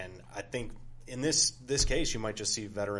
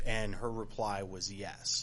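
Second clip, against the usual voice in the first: slow, deliberate punchline; slow deadpan delivery; 2 semitones lower, 3 dB quieter, slower than usual. Real, not acted. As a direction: drag out the punchline. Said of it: "punchline, slow".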